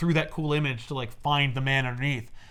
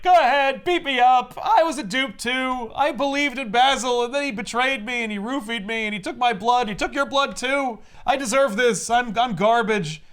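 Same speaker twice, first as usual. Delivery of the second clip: high, pleading, self-pitying voice; self-loathing Lady Clay; 9 semitones higher, 4 dB louder than usual. Retold or imitated. Lady Clay tells Preston to beat her, high and self-pitying. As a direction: play her begging him to hit her.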